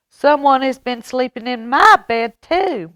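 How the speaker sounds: mama bear cry. Acted. Mama Bear finding her bed slept in, crying out high. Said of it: "Mama Bear cried".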